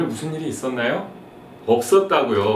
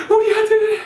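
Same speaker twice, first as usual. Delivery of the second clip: high, laughing mock mom; mock eager mom; 4 dB louder than usual. Mothers resolving to feed sons green juice, hammed-up high. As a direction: squeal it like a convinced mother. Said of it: "막 어머님들이".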